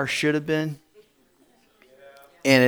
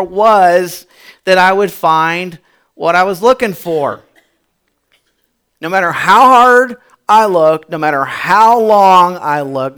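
preaching; 4 semitones higher, 6 dB louder than usual. Acted. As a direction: hammer the lesson home from the pulpit. Preacher drives home sermon point.

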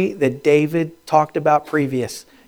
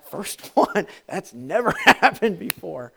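shocked laugh; 4 semitones higher, slower than usual. Retold or imitated. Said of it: "He's like".

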